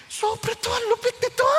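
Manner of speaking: high, choked exclamation; moved to tears. Real, not acted. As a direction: voice breaking with emotion. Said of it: "moved exclamation".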